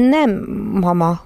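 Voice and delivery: hesitant, frightened no; mildly quavering denial; trembling. A narrator's reading. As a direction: answer mama fearfully, with a pause. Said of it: "mondta reszketve Fanny".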